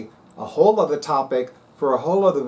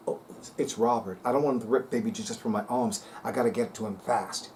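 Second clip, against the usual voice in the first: quiet, hurried, lower worried saint voice; panicked saint; 5 semitones lower, 8 dB quieter, faster than usual. Retold or imitated. Imitating Saint Anthony rushing to keep baby Jesus, panicked. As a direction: fret quickly under your breath like a startled saint. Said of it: "Saint Anthony's like".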